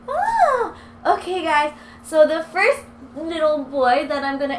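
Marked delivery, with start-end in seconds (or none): 0.1-0.7 happy squeal. Real, not acted. Dog jumps up, squeal.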